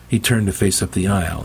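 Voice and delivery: usual speaking voice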